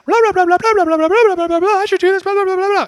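frantic inner chatter. Retold or imitated. Preacher voicing the chaos in your mind, loud frantic babble. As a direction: rattle it off like a head full of noise. Mimics chaotic voices in crisis.

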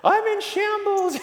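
high, slow, wailing distress; a hammed-up cry of falling apart; mock despair. Telling his mentor Chad he's a mess, high mock wail. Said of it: "And I'm like".

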